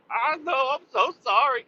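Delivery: high, sheepish mock sorry; mock apology. Mock apology to imagined wife.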